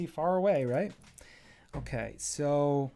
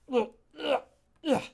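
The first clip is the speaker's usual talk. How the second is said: much higher straining grunts; effort grunts; 12 semitones higher, 3 dB louder than usual. Acted. Little pillow climbing onto the chair, high straining grunts. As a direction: grunt as if hauling yourself up.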